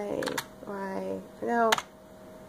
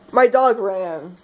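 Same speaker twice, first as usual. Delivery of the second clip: ordinary talk, nothing marked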